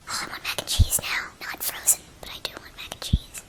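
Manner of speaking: soft whispered craving; hushed longing for mac and cheese. Real, not acted. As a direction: whisper it like a secret wish. Craving aside to herself.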